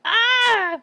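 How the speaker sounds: loud high "Ah!"; startled scream. Acted. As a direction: cry out in sudden shock. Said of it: "Keep your voice down, [name]".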